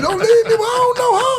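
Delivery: high, loud hammed-up plea; laughing mock panic. Begging his friend not to leave, laughing high plea. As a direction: beg through laughter in mock fright.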